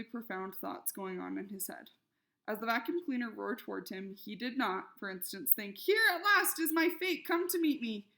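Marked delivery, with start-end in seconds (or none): 5.8-8.1 high, loud mock-tragic voice; dramatic squirrel. A narrator's reading. Imagined squirrel facing the vacuum, hammed-up tragedy. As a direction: ham up the squirrel's fate speech.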